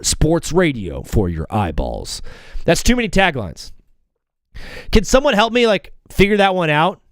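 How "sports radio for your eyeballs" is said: lower, slowing radio voice-over; announcer voice. Shown as a demonstration. Host testing a longer tagline in announcer voice. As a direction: let it sink into a deep station ID. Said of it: "or we go".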